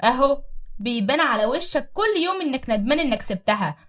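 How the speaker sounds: bang the table and shout it; loud accusation. Acted. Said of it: "وقالت بصوت عالي". (صياح غيرة عالٍ)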